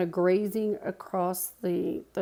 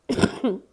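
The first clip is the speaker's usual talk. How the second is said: chesty cough; 4 semitones higher, 5 dB louder than usual. Real, not acted. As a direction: cough from the chest, then recover. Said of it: "Sorry about my coughing".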